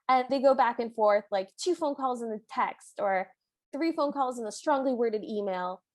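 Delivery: ordinary talk, nothing marked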